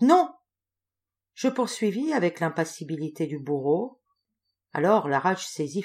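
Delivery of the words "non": high, loud burst of refusal; hissing voice. A narrator's reading. Old woman to her tormentor, hissing cry. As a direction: hiss the refusal sharply, louder and higher. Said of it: "fit-elle d'une voix sifflante".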